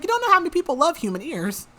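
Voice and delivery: high-pitched, quick imitation of the fairy; mock-enthusiastic fairy, pitched well up; animated retelling. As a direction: rattle it off high, playfully quoting him. Fairy's quirky boast, retold.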